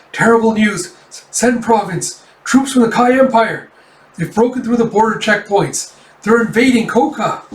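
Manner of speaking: high, loud, urgent alarm; panicked messenger. Acted. Messenger running in to warn of invading troops, panicked shout. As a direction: burst in crying out the alarm. Messenger bursts in with invasion news.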